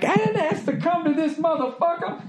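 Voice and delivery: high, hushed, indignant mutter; Richard Pryor quote. Retold or imitated. Pryor fuming in the back room, hushed indignation. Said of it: "that Richard Pryor bit".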